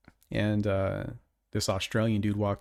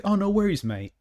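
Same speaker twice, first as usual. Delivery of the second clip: a hammed-up Australian accent, pitched higher; bad Australian accent; 10 semitones higher than usual. Customer imitated reassuring the cashier, bad Australian accent. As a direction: toss it off like a cheery Aussie in a bad Australian accent. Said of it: "horrible Australian accent".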